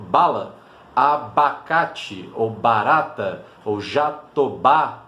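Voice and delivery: "abacate", "barata", "jatobá" ditas devagar; palavras bem pausadas. Shown as a demonstration. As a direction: soletre cada palavra lentamente, realçando o "ba". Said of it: "Por exemplo". (palavras com "ba" ditas devagar para mostrar o som)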